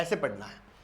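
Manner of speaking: normal talking voice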